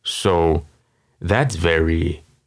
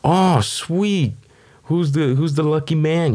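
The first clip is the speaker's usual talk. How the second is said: much higher, over-sweet mock cheer; supportive grandchild; 9 semitones higher than usual. Grandchild asking grandma about her date, sweetly. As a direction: gush with fake-bright support. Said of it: "you're just like".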